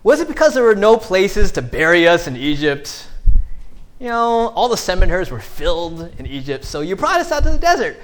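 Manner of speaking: high, mocking sarcastic whine with a laugh; big sarcasm. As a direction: sneer it back at Moses. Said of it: "Big sarcasm".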